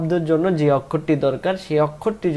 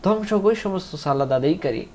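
usual speaking voice

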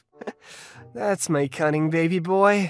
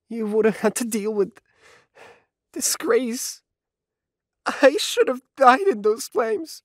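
anguished self-blame; 3 semitones higher than usual. Acted. Richie blaming himself for hurting his partner, anguished. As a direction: build from regret into distraught self-loathing. Guilty breakdown confessing to partner.

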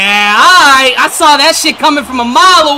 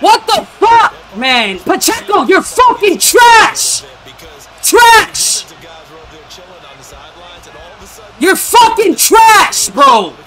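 furious shout; slower than usual. Real, not acted. Raging at fumbling player.

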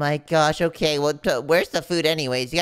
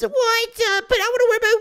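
whiny squeal; 5 dB louder than usual. Acted. Whining about uniform.

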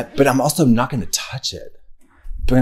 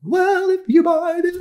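high slow falsetto ad read; [name] voice. Retold or imitated. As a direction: pitch way up for a mock ad. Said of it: "you have to do an ad as [name]".

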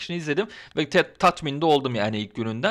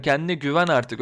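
normal talking voice

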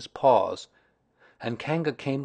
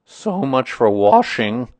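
slow, loud grumble; ponderous grumbler proved right; Eeyore; 2 semitones higher, 10 dB louder, slower than usual. A narrator's reading. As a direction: drag it out sardonically. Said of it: "said Eeyore".

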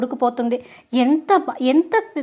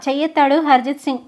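usual speaking voice

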